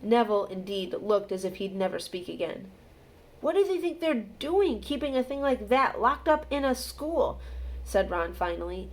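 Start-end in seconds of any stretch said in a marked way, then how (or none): 3.4-7.4 high, incredulous outrage; appalled, high-pitched complaint; indignant disbelief. A narrator's reading. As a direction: protest at something utterly mad. Ron outraged about the dog.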